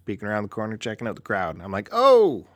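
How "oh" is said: high, loud startled "Oh!"; sudden burst of surprise; surprised gasp. Retold or imitated. Spots Marc Maron backstage.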